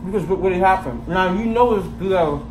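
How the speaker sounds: chewing while talking; talking around a bite; mouth full. Real, not acted. Seen 1: Cheeks puffed, mouth working on bread bitten just before.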